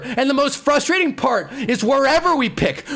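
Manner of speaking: normal talking voice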